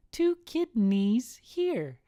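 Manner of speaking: put-on high, slow, drawn-out child voice; little kid punchline. Son delivers the punchline, slow high kid voice. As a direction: stretch it out slowly as a kid.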